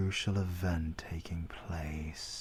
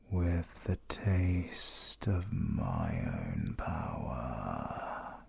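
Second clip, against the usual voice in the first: menacing incantation; 6 semitones lower, 5 dB louder than usual. Acted. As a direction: breathe each word of the curse at the victim. Villain drains victim's life force.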